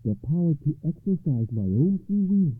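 high, loud, triumphant; exultant noblewoman's ringing declaration; joyful lady. A narrator's reading. Lady rejoices at broken spell.

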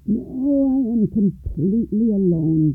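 put-on gasping old man, pitched high; an old man short of breath; breathless old person. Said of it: "gasped the old man".

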